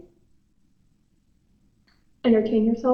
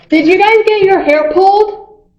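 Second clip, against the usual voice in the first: raised, higher questioning call; 8 semitones higher, 5 dB louder than usual. Real, not acted. Guest asks people in the basement, calling out. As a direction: shout a question down the stairs.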